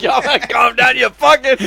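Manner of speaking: high, laughing echo of the yell; laughing imitation. Co-host echoing friend's neighbor bit, high laughing imitation. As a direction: repeat the neighbor's holler while cracking up. Repeats the bit while cracking up.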